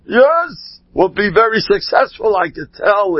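high, gushing imitation of the rabbi praising someone; raving praise. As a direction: rave about him like an enthusiastic old rabbi. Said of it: "And he was raving".

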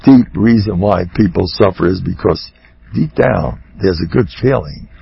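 usual speaking voice

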